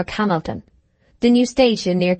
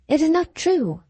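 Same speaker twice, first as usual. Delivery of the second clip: passionate; 9 semitones higher than usual. A narrator's reading. Stephen protests passionately to Knight, voice leaping high. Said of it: "said Stephen passionately".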